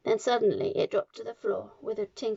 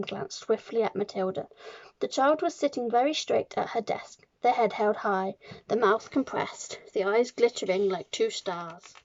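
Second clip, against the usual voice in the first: ordinary delivery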